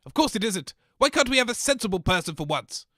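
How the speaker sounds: ordinary delivery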